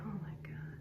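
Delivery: whispered, retold dread; hushed, voiceless dismay. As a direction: say it under your breath, all air. Dreading reaction to boss's summons, retold.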